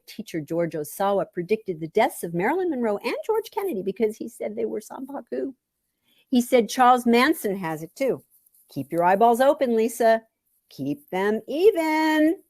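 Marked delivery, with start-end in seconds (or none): none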